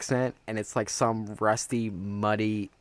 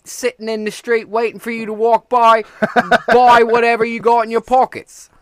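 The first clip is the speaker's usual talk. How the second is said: loud, high Cockney hawker; London street urchin accent; 12 semitones higher, 11 dB louder than usual. Acted. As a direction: shout it out in an English urchin accent. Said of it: "that guy right there".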